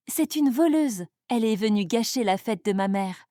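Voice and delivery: ordinary delivery, nothing marked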